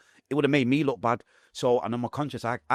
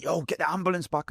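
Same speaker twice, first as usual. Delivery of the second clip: retold high, held-back cry; unshouted plea; 8 semitones higher than usual. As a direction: plead for help without the strength to shout. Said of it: "I'm not shouting that out".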